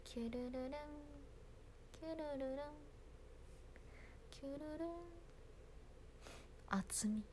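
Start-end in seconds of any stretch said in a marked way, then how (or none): 0.0-4.9 higher, softer trilled kyururun; cute trilled kyururun. Acted. Idol doing her cute kyururun trill, soft and high.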